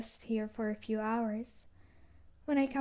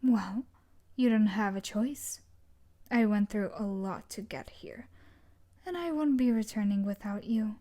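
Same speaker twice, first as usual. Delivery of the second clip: ordinary talk, nothing marked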